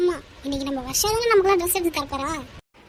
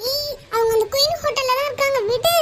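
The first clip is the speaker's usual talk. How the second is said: high, strained confession; yelping answer under pain; pained blurting; 7 semitones higher than usual. Acted. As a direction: blurt it out to get released. Blurts answer while held.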